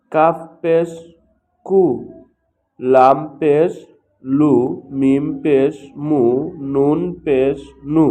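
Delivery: loud, slow, measured syllables with pauses between; letter-by-letter recitation drill. Shown as a demonstration. Teacher showing learners how to pronounce pesh, in a loud recitation drill. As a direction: call out each letter and its vowel slowly and loudly.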